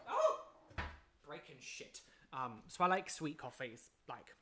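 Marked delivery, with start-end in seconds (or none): 0.1-0.4 sudden high, loud yelp of surprise; startled cry as something breaks; surprised. Real, not acted. Sudden mishap on camera.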